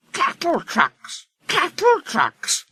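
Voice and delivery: very high, slow chanted grumble; offended coaches grumbling in rhythm. A narrator's reading. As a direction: mutter it like a chugging rhythm. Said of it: "grumbled the coaches".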